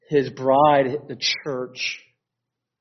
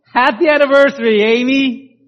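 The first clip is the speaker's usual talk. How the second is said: bright, fast, high happy anniversary; excited greeting; 10 semitones higher, 7 dB louder than usual. Retold or imitated. Husband greeting his wife at the door, excited.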